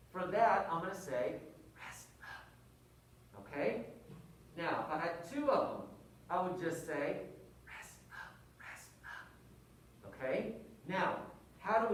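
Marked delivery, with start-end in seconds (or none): 1.8-3.4 unvoiced whisper. Shown as a demonstration. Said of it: "but I'm whispering". 7.7-10.1 unvoiced double whisper. Shown as a demonstration. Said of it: "but I'm whispering".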